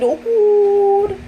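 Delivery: sudden high exclaim of approval; surprised delight. Real, not acted. Preacher pleased when the congregation agrees, sudden high exclaim. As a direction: pop up high and pleased at the crowd's response. Audience reacts to her point.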